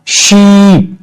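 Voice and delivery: शीप with a long, high stretched ee; teacher modelling a long vowel; long ee demo. Shown as a demonstration. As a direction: hold the ee in शीप. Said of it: "जैसे देखो ना".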